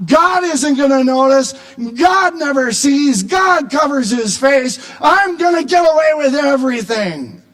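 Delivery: loud, high defiant boast; wicked man boasting. Acted. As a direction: scoff at God, sure nothing will happen. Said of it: "He says to himself".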